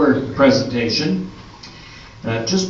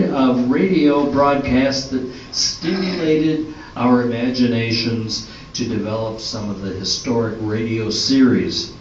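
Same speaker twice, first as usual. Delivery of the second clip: usual speaking voice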